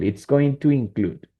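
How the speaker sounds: ordinary talk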